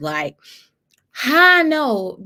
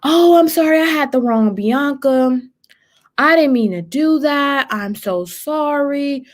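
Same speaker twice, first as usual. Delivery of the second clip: mock apology; 5 semitones higher than usual. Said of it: "She commented back like".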